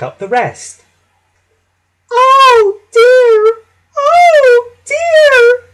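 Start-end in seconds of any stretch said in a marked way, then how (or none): 2.1-5.7 very high, loud drawn-out wail; groaning trucks. A narrator's reading. Troublesome trucks after the crash, high groaning. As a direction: moan in a squeaky high put-on voice. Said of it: "they groaned".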